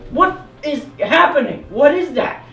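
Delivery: high, loud frightened cry; mock spooked shout; startled panic. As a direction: yelp at the shaking bush. Scared by shaking bush.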